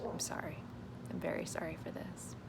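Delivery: soft whispered sorry to viewers; whispered apology. Real, not acted. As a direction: apologize under your breath. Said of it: "apologizing to viewers in whisper".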